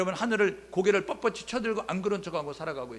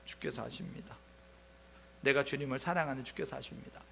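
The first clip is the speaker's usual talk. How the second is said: much softer, lower, cowed answer; shrunken reply; 3 semitones lower, 8 dB quieter than usual. Retold or imitated. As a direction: answer small and ashamed. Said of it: "위축이 되잖아요". (위축된 채 아주 작게 대답하는 베드로)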